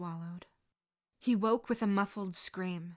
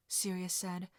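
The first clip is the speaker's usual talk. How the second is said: plain reading voice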